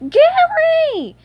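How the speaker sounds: very high, loud drawn-out cry; horrified shout. A narrator's reading. SpongeBob seeing his pet in trouble, horrified shout. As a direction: shout the name in horror. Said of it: "he shouted".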